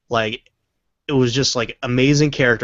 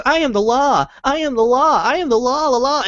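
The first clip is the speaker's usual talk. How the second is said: much higher, frantic, repeating himself; imitating a little kid parroting the troll; kid's breaking parody; 13 semitones higher, 3 dB louder than usual. Retelling the troll video, the kid cracking in a high voice. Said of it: "He goes".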